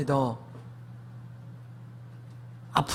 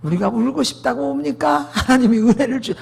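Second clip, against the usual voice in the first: higher-pitched sermon voice, pushing out the question; preaching pastor; 7 semitones higher, 3 dB louder than usual. Retold or imitated. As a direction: imitate a pastor challenging the congregation from the pulpit. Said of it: "목사님 설교 속에 나왔어".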